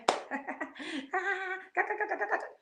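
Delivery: high cackling burst of laughter; a quick rattling real laugh; sudden laugh. Real, not acted. Vlogger laughing about her community never laughing, real cackle.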